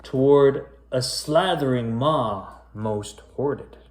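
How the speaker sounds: spooky rhyming storybook read; story narration. A narrator's reading. Back to reading the creepy rhyme to kids, storyteller voice. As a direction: pick the tale back up, storyteller voice.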